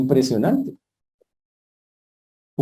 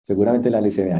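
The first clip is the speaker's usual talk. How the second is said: ordinary delivery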